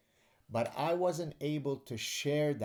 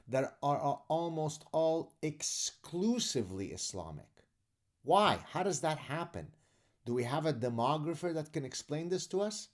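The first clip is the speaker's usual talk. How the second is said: pissed off, raised pitch and hard emphasis; real exasperation; 6 semitones higher, 4 dB louder than usual. Real, not acted. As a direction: fire off indignant questions, higher and louder. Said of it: "I'm pissed off".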